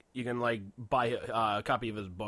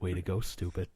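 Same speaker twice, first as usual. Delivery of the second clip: mock conscience voice; 5 semitones lower than usual. Lightly voiced conscience scolding Bruce for bad cover-up. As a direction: scold him like his own conscience. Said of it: "your conscience speaking".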